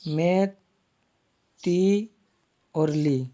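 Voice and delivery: मैथिली broken into मै, ती, ली with pauses; syllable-by-syllable demo. Shown as a demonstration. Teacher showing how मैथिली is formed, syllable by syllable. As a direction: spell it out part by part, each syllable held. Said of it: "मैथिली इस प्रकार बनेगा".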